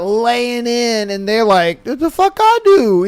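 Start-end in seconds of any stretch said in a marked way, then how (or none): 1.9-3.1 baffled. Retold or imitated. Voicing baffled recipient.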